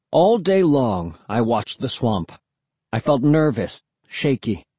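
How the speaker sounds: ordinary delivery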